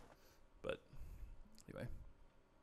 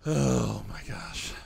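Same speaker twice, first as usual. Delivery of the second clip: stretched out slowly and louder; slow, drawn-out; 2 semitones lower, 6 dB louder, much slower than usual. Real, not acted. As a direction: drag the words out in dread. Stressed by the strong enemy.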